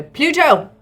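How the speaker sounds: loud called-out instruction, easing off toward the end; shouting to an ally. Acted. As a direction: shout orders across a battlefield. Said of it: "And I shout to Pluto".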